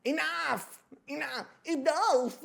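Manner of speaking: high-pitched, hammed-up fed-up cry; theatrical squealed protest; mock exasperation. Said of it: "I'm like".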